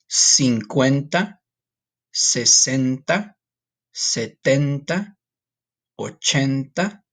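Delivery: slow, careful count by tens; counting in Spanish. Shown as a demonstration. Teacher counting by tens for the class, slow and clear. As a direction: model each Spanish ten slowly. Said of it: "we'll do it by tens".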